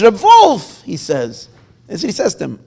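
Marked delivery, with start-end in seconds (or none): 0.0-0.8 calling out. Acted. Old man calls out greeting.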